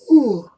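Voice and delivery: loud, long drawn-out yawn. Real, not acted. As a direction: let out a big tired yawn. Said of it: "Sorry for the yawn".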